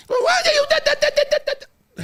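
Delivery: high shrieking yell at a caller, close to crying; a child screaming at the phone, voice cracking; mock phone yelling. Kid yelling into the phone, high and shrill. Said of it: "looking at the phone yelling".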